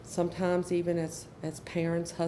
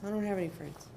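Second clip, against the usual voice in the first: a hint of a mopey kid, softer; sulky child; 6 dB quieter, faster than usual. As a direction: mumble it like a sulking teenager. Sulky child answers parent.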